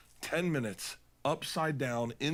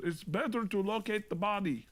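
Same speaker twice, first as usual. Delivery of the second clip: higher-pitched imitation Swiss accent; Swiss guide accent; 7 semitones higher than usual. Swiss guide explaining beacons to a snowboarder, put-on Swiss accent. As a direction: play a Swiss mountain guide with accented English. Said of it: "that's the Switzerland guy".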